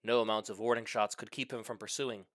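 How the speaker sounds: usual speaking voice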